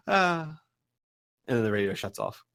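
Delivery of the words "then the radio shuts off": usual speaking voice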